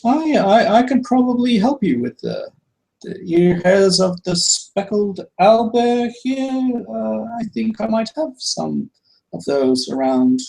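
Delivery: put-on high, polite old shopkeeper; welcoming the party back to the shop, high and courteous. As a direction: greet the customer in a lifted, genteel voice. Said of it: "and he's like".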